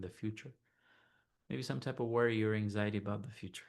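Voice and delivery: soft, unhurried meditation voice; guided meditation. Acted. Teacher leading listeners to notice body and heart, calm meditation voice. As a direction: gently guide them inward, leaving long silences.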